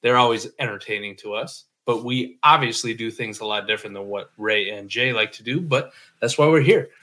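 ordinary delivery

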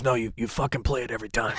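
low, soft, deadpan scolding; quiet dry boss voice, laughing through; [name]'s impression. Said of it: "He goes".

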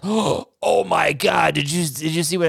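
slow, drawn-out amazed exclaim; shocked gasp. Retold or imitated. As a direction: act stunned by a plot twist.

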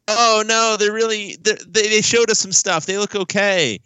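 high-pitched imitation of fretting fans; mock worried fans. Host mimics fans after the Oklahoma game, high mock-worried voice. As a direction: pitch it up, imitating people backpedalling from panic. Acts out worried fans' reaction.

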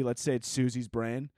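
usual speaking voice

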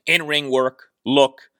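ordinary talk, nothing marked

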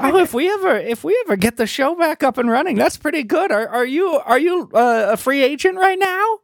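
high, quick, keen talent scout; eager fairy. Acted. Fairy taking notes and recruiting the rapper, high and eager. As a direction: jot it down, thrilled.

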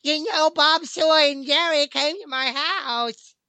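Jerry comma voice. Retold or imitated. Host doing the Jerry comma, high silly voice. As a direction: squeak it out in a silly high cartoon voice. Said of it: "Or the Jerry comma".

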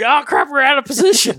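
high, loud mock alarm; panicked surprise. Hunters caught off guard by running gobblers, high alarmed blurt. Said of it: "and we're like".

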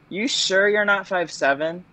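probing, as if hinting at the answer; sceptical casting director. Retold or imitated. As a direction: lightly imitate a doubtful casting director. Imitates casting director's sceptical question.

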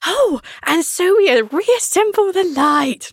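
high, slow mocking granny voice; ancient grandma. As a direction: mimic an ancient grandma reading a story. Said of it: "like, ancient grandma, like, trying to read".

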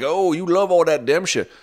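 mocking critics. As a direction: parody the commenters' accusing tone. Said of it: "everyone's like".

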